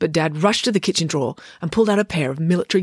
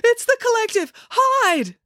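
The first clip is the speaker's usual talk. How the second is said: much higher, frantic cry to hide; panicked warning. A narrator's reading. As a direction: sound the alarm in blind panic. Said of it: "Dad gasped and ducked".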